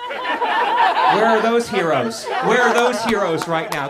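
slow, high, loud mock indignation; calling out the straw banners, drawn out and loud; mock outraged rant. Comic calling out straw activists, loud and high.

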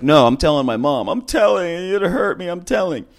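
a hint of a hurt child; whiny little kid. Kid threatening to tell mom.

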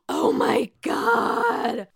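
high, slow dramatic gasp; shocked gasp. Retold or imitated. Vlogger acting Marge's mom reacting to the rumor, high slow gasp. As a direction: gasp high then drag out oh my god. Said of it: "Marge Senior spills the tea".

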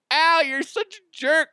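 put-on high, whiny kid complaining; whiny kid sibling. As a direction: whine like a hurt little brother. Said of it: "I'd be like".

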